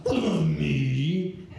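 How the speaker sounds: drawn-out put-on wail; stand-in impression voice. The ex's text read out in reply, drawn-out wailing voice. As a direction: draw it out in a strange put-on voice. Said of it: "so that's the voice".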